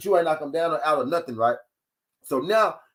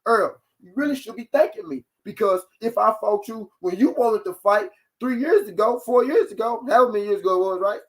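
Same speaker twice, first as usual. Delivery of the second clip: higher, mocking imitation of a boastful rival; mock Thurman quote; 6 semitones higher than usual. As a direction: lightly parody him bragging. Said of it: "'cause you said this last week".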